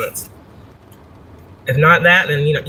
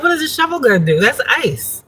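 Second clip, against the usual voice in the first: higher pitch, incredulous scoff at the shovel; mock disbelief; 8 semitones higher than usual. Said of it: "I'm like".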